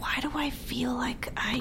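slow breathy whisper to yourself. Retold or imitated. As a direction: whisper it like a private thought. Imagined thought on meeting someone.

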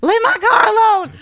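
high, loud re-enacted shout; retold frantic yell. Host retelling her dash to save her car from ticketing, frantic yell. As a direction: yell it out as you re-enact running for your car.

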